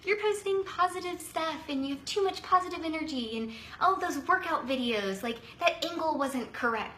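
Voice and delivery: high, sneering mock of a coworker; a hint of a snide coworker teasing. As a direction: lightly imitate a coworker making fun. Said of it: "make fun of me and say".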